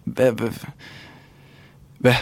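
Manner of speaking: ordinary talk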